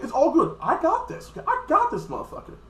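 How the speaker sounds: high-pitched hammed-up boast; mock Krillin climbing into a squeal; squeaky cocky Krillin. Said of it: "And Krillin's like".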